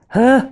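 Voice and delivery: high, loud gasp of shock; acted startled gasp. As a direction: gasp sharply as if jolted. Acts out the startled cramp. (gespielt erschrockenes Aufkeuchen)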